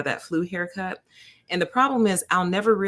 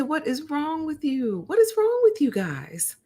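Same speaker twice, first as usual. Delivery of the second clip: high mock disbelief; 9 semitones higher than usual. Questioning the nurse, high mock outrage. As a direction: ask it high in playful outrage. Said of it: "I said".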